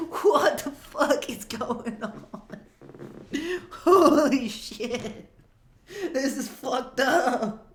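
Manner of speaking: high, slow, laughing outrage; laughing disbelief. Retold or imitated. Guest reacting in shock at the weird date, laughing. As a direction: squeal it out through laughter. Guy freaking out at the wardrobe.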